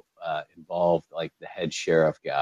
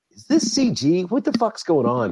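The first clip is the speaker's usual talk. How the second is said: high-pitched incredulous questioning; baffled reaction; 10 semitones higher than usual. Retold or imitated. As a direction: retell your own disbelief in a raised, higher voice. Acts out his baffled viewing reaction.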